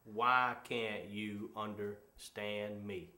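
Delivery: low, very slow, word-by-word frustration; frustrated. Acted. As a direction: spell out your exasperation slowly and quietly. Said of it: "just the frustration sits in".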